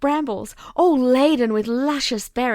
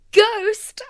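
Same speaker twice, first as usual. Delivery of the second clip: high, loud wail; terrified child wailing at the ghost; frightened wail; 9 semitones higher, 4 dB louder than usual. A narrator's reading. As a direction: wail it in fear. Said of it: "I wailed".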